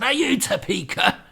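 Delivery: loud demanding question; man's demand. Acted. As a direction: bark the question. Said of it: "he said".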